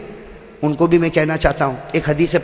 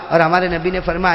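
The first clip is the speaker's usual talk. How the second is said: ordinary talk, nothing marked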